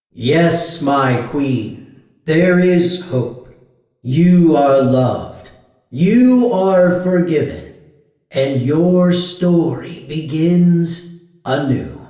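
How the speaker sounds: loud, resounding mirror voice; magic mirror. A narrator's reading. Magic mirror answers the queen's plea, loud proclamation.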